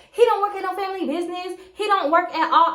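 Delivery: retold, insistent contradiction; indignant wife. Retelling angry wife's rebuttal.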